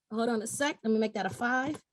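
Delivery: a quick aside to herself mid-demo; muttered aside. Real, not acted. Presenter pauses the webinar to fix a number, quietly to herself. Aside while editing the calculator.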